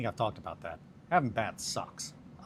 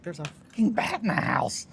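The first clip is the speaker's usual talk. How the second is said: alarmed yell; 8 semitones higher, 9 dB louder than usual. Retold or imitated. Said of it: "she was like".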